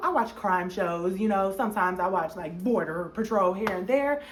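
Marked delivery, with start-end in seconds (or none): none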